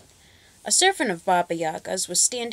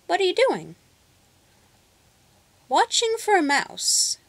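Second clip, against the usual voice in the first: put-on girl's question; 10 semitones higher, 4 dB louder than usual. A narrator's reading. Girl and cat voiced.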